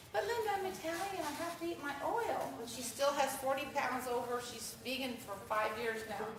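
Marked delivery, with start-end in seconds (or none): 0.1-2.5 lightly voiced friend, pitched higher, protesting; imitating Italian friend. Friend insisting she must eat oil, mock higher voice. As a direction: mimic the friend's plea, voice lifted up. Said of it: "she goes".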